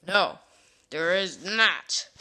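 loud and slow; loud refusal. Acted. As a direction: shut the idea down loudly. Firm refusal.

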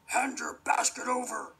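wolf voice. Acted. Wolf threatens.

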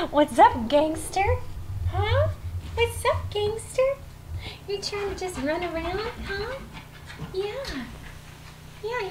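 soft, very high cooing; pet talk. Real, not acted. Greeting the three-legged dog, soft high pet talk. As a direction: coo playfully to a dog.

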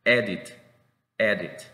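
"edit" with a short, slightly smiled E; careful, separate "edit"; short E demonstration. Shown as a demonstration. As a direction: model "edit" as single careful words. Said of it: "una sonrisa no tan prolongada".

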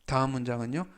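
normal talking voice

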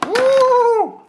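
high excited ooh; delighted squeal. Real, not acted. Delighted at the toys.

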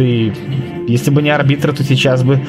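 usual speaking voice

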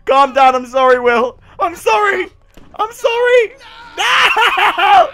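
loud, high, hammed-up wailing; mock frantic apology. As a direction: shout apologies in fake panic. Apologises to burning character.

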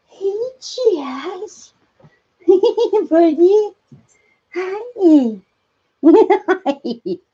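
high, giggly coo. Real, not acted. Owner giggles and coos high at her chipmunk. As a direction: delight at the little pet, laughing between words.

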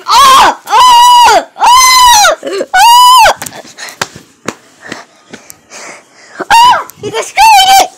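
playful high panic screams over the ruined show; high-pitched screams. Pretend. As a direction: scream high and loud as the puppet show gets wrecked. Puppet scene, baby wrecks show.

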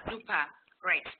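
ordinary talk

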